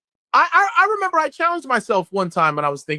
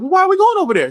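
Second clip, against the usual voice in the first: high, louder nervous question; scared boy; 10 semitones higher, 3 dB louder, faster than usual. Retold or imitated. Young son asking his mom, high and nervous. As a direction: ask it like a frightened kid. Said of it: "And I'm like".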